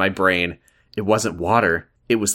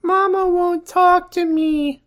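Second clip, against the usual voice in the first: put-on very high, drawn-out child voice; little girl Megan; slower than usual. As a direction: squeak it slowly like a small child. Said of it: "Megan says".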